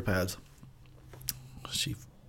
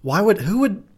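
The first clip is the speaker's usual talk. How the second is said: ordinary talk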